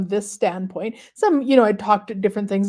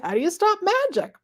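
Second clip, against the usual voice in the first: playfully voiced quote; 10 semitones higher, faster than usual. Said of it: "She says".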